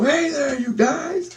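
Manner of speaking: very high shout without the volume; high yell held back. Retold or imitated. It's four in the morning, a high but restrained yell. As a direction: pitch it up but keep it down. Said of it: "I go".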